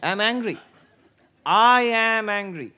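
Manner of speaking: demonstrating how the mind claims anger, rising to a mock shout. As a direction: act out the angry self, building to a loud slow I am angry. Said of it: "No, I say".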